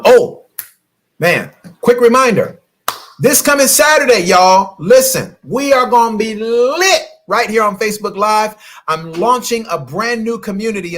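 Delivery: loud, high hype for Saturday; excited announcement. Real, not acted. Coach announcing her Saturday live launch, loud and excited. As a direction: pump up the audience for the launch.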